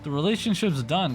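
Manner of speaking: high-pitched outraged protest; fed up, calling them out; indignant rant. Retold or imitated. Ranting at car thieves.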